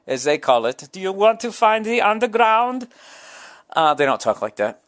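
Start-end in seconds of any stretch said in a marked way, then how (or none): 0.9-2.9 a hammed-up, vaguely Swedish foreign accent, pitched higher and louder; hacky foreign accent. Mimicking Stockholm locals asking about the subway, in a hacky Swedish accent. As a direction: play a cheesy Swedish tourist-guide accent. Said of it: "that's my hacky foreign accent".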